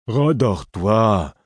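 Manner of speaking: high, drawn-out grumpy whine; half order, half complaint, dragged out slowly; whining order. A narrator's reading. Sleepy Lourd ordering the shouters back to bed, plaintive. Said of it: "entre un ordre et une plainte".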